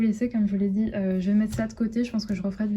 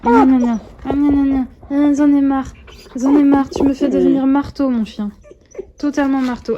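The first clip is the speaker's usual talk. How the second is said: loud, high exasperated scolding of the puppy; half-laughing fed-up yelling at a biting dog; 4 semitones higher, 10 dB louder than usual. Real, not acted. (gronde son chiot, fort et exaspérée)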